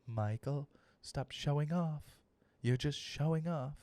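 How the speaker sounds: soft, slow mock British scolding; British mom. British mother telling her son off, soft and slow. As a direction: quietly chide like a British mum. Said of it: "my mom would be like".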